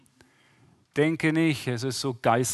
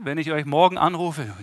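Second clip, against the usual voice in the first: normal talking voice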